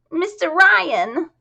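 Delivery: high, slow, squeaky delighted call; squeaky chorus girl. Acted. Chorus girl greeting Ryan, high and squeaky. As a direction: call out to him, squeaky and thrilled. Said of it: "rather squeakily grating".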